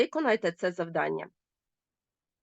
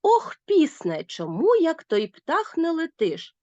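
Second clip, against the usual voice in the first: slow, lifted "Ох, пісне" with an exclamatory tune; exclamatory reading; 6 semitones higher, slower than usual. Shown as a demonstration. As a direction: read the poem line with feeling, slowly. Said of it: "Звертання".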